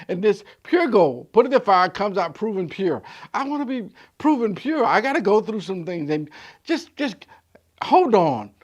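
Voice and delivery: loud rising preacher's call; full-force preaching. Acted. Preacher building to a shout for the church. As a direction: preach it out to the congregation.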